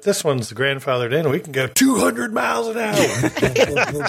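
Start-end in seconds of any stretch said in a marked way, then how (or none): 1.3-4.1 slow, high, laughing boast; boastful ride operator. Retold or imitated. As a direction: brag it with a laugh.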